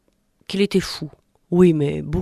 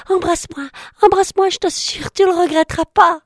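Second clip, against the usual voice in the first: much higher, pleading, boastful; frog princess voice; 7 semitones higher than usual. Acted. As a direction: sell yourself to him, squeaky and eager. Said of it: "la grenouille lui dit".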